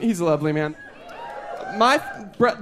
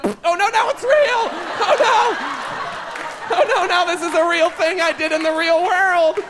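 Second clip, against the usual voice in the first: mock panic; 9 semitones higher than usual. Comic recalling the massage, shrieking in mock horror. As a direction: squeal oh no in hammed-up dismay. Panics as the joke turns real.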